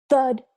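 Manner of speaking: loud, punchy thud; loud thud effect; mock thud. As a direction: land it with a bang. Sound effect of landing.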